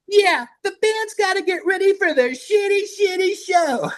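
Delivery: very high mocking falsetto parody. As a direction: sneer at the announcement in a silly high voice. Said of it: "I was just fucking around".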